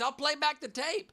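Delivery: high-pitched Southern protest; Southern accent. Pretend. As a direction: threaten it in a put-on Southern voice. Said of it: "the accent is perfect with gaslighting".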